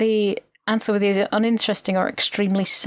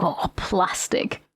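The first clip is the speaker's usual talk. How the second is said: sarcastic; 5 semitones lower, slower than usual. Retold or imitated. Mock unimpressed reply about plastic.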